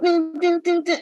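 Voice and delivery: high hummed riff; sung ukulele riff. Retold or imitated. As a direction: imitate the strumming tune. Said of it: "It sounds like everybody's playing a ukulele".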